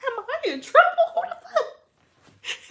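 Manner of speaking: high, laughing, fading to a breathless whisper; laughing disbelief. Retold or imitated. Sister giggling in disbelief at husband's call, high laughing whisper. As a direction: laugh through the shock. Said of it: "I was like".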